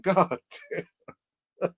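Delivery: usual speaking voice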